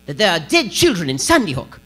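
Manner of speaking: hammed-up put-on outrage, lightly voiced; Piers Morgan mock. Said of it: "He would say".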